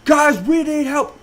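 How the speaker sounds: high, urgent shout; yelling for help. Retold or imitated. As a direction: call out loudly to people across the gym. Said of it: "I'm yelling for my trainers".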